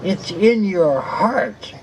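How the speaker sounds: slow, drawn-out hint of a witch; lightly witchy retort. Swami answering his devotee, mildly witch-like. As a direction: imitate a wicked witch, lightly, stretching the words. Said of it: "The wicked witch of the West".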